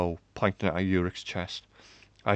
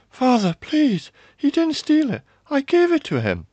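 high, loud, frantic pleading; desperate plea; 9 dB louder than usual. A narrator's reading. Daughter begs her father for the prisoner, high and desperate. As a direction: burst out begging for someone's life. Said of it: "the words tumbled out".

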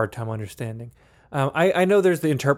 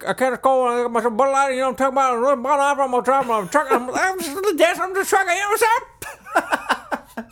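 high, hammed-up Southern good old boy getting more excited; rising higher as the yokel gets giddy; excited Southern accent; 12 semitones higher than usual. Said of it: "my, "I'm from the South, I'm getting excited" accent".

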